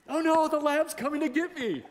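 high, loud hammed-up fright; mock panic. Preacher joking to the congregation about fearing a lab, hammed-up fright. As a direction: fake terror over a harmless dog.

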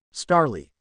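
usual speaking voice

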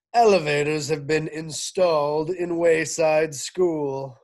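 loud principal on the PA; over the loudspeaker. Acted. Mister Kidswatter greeting school, loud loudspeaker announcement. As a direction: boom it out over the speakers. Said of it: "voice came over the loudspeaker".

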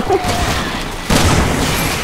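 soft laughter; real laugh. Real, not acted. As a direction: laugh it off quietly. Risa por lo que pasó. (risa suave)

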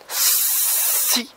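slow "si" with a long soft hiss. Shown as a demonstration. Teacher sounding out S plus I. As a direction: stretch the S in "si". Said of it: "ça va faire".

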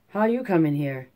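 ordinary delivery, nothing marked

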